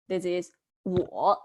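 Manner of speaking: normal talking voice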